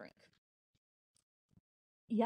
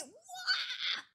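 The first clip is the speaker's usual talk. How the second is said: playful whoop; 4 dB quieter than usual. Pretend. After big jump.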